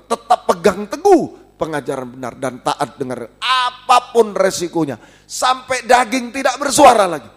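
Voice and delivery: loud, driving sermon voice; fiery preaching. Acted. Pastor warning the church against false teaching, loud preaching. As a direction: preach it hard to the congregation.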